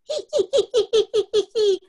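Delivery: high, loud mischievous giggle. A narrator's reading. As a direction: cackle like a naughty child. Ronald giggles at the prank.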